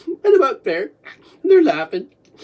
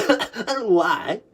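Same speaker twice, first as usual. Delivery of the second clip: ordinary talk, nothing marked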